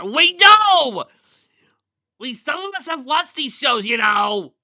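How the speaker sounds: high-pitched, outraged interruption at full volume; shouted protest. Acted. Reader cutting off the story to object, shouting high. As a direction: yell it out, very high and loud.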